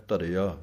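ਧਰਿਆ opening on a clear ਧ, not ਅਰਿਆ; with a full ਧ. Shown as a demonstration. Teacher shows students the ਧ in ਧਰਿਆ. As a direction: sound the ਧ of ਧਰਿਆ fully. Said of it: "ਧੱਦੇ ਦੇ ਨਾਲ ਬੋਲਣਾ ਹੈ".

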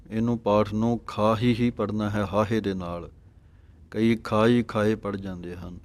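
ordinary talk, nothing marked

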